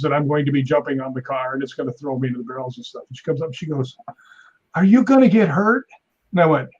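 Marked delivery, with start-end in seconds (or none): 4.7-5.9 raised, louder worried co-star's question; lightly voiced anxious actress; acted concerned question. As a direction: ask it higher, imitating an anxious onlooker.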